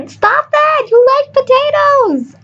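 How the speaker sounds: high-pitched, drawn-out yell; mock shout. Shown as a demonstration. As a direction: call out a silly warning from the sidelines. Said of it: "I'll probably just shout".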